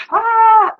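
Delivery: loud, high-pitched mock wail; drawn-out, high "ah" mimicking a child; imitated child's outburst. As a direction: cry out like an upset child. Child arrives crying out, imitated.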